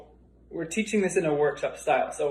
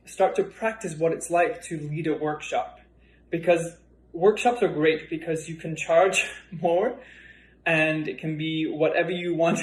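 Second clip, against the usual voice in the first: ordinary delivery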